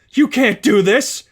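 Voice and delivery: villain overloaded, yelling in denial; shouting. Acted. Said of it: "she shouted".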